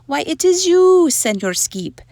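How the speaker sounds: put-on Spanish accent, opening high and loud. A narrator's reading. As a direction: mirror chihuahua answering in a Spanish accent. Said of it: "using his very best Spanish accent".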